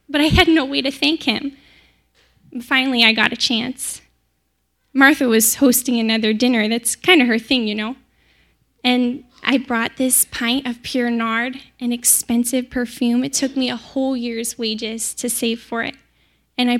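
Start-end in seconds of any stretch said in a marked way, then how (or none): none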